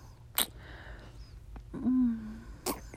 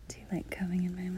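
whispering; 2 semitones lower, 6 dB quieter than usual. Acted. Whispers throughout, intimate.

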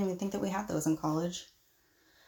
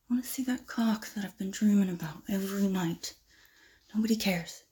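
seductive whisper. Acted. Seducing the professor.

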